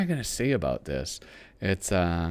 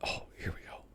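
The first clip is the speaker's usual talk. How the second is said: whispering; 18 dB quieter, faster than usual. Real, not acted. Quiet aside while turning pages.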